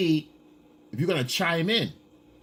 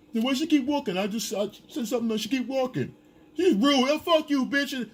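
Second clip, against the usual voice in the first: high-pitched, whiny mock outrage; indignant catcaller; 11 semitones higher than usual. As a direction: imitate a snubbed guy griping. Voices a rejected catcaller complaining.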